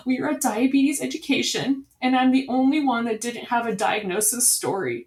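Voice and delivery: lightly imitated aggrieved kid; upset little boy. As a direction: voice the son complaining to mom. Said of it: "He goes".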